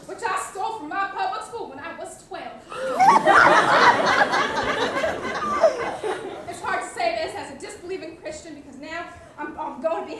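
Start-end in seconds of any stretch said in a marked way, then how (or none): 2.7-6.4 high loud laughter. Real, not acted. Cast losing it at the confession, loud laugh. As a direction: burst out laughing for real.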